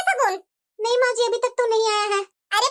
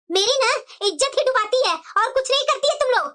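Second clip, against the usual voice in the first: normal talking voice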